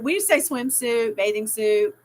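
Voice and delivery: ordinary delivery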